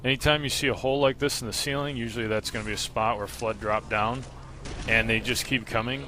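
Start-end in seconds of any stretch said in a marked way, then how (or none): none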